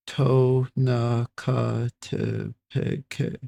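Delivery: Tonacatepec very slowly, each syllable separate; sounding out. Real, not acted. Reader searching for the pronunciation, slowly sounding out Tonacatepec. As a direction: spell out "Tonacatepec" by ear, syllable by syllable. Sounding out unfamiliar place name.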